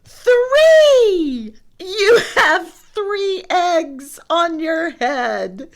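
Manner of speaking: high, loud, slow squealing cry; Piggy thrilled to tell Gerald the news; excited cry. Acted. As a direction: shout it out with delight. Piggy announcing eggs.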